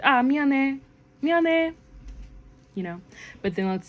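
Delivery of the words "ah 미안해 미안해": higher, loud breezy "미안해"; quick casual sorry. Pretend. Apologizing to a friend after tripping them, breezy and high. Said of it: "accidentally tripped your friend".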